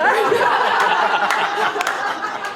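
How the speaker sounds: high, loud burst of laughter; loud high laughing burst; big laugh. Real, not acted. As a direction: laugh hard at the irony. Audience-worthy laugh at the irony.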